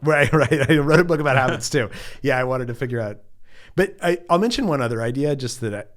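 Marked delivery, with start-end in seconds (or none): 0.0-1.9 laughing. Real, not acted. Laughs at being called expert.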